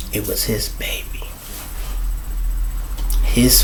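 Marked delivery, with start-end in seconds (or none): 0.1-1.3 hushed, breathless whisper of the reveal; whispered secret. Real, not acted. Telling the audience whose baby it was, a hushed whisper.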